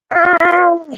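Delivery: high, loud startled squeal; nervous squeal. Real, not acted. Painter squeals while making the risky brushstroke, high and loud. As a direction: let out a sudden high yelp of nerves. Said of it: "Yes, I'm making noise".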